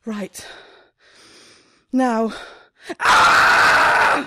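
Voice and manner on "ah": short loud cry of exasperation; a burst of pent-up frustration; frustrated yell. Acted. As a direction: let out a sharp, breathy yell of despair. Frustrated outburst alone in shop.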